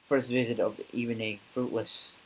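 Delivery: usual speaking voice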